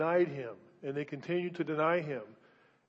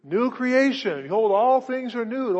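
loud, higher ringing proclamation of the verse; proclaiming; 6 semitones higher, 8 dB louder than usual. Real, not acted. Preacher opening the sermon to his congregation, ringing proclamation. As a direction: call it out to the whole church.

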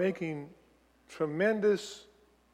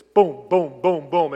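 short, punched-out beats; hammered staccato rhythm; 7 dB louder, slower than usual. Shown as a demonstration. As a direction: hit each boom as a separate loud beat. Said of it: "it's very staccato".

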